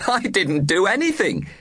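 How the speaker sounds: high honking lad; honking boy voice. A narrator's reading. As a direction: honk it out like a boy. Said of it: "honked young Plug".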